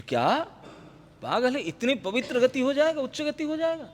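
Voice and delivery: mock how dare you disbelief; indignant outrage. Retold officials scoffing at the dead saint's honour, in indignant outrage.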